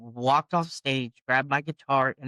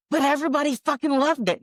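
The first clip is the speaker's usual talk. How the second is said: high, fast acted objection; indignant protest; 13 semitones higher, 4 dB louder, faster than usual. Singer argues back to the boss, indignant. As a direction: argue back in disbelief, pitch way up.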